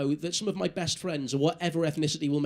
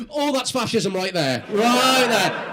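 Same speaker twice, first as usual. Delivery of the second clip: loud, hammed-up outrage at the crowd; mock outrage; 3 semitones higher, 8 dB louder, slower than usual. Real, not acted. Comedian shouts at audience, hammed-up outrage. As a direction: bellow it like a shocked heckler.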